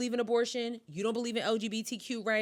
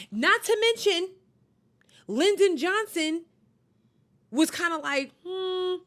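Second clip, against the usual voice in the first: higher and louder, slowing on Lyndon Johnson; animated storytelling; 6 semitones higher, 4 dB louder than usual. Real, not acted. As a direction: build up the reveal with emphasis. Mocking retelling of Lyndon Johnson.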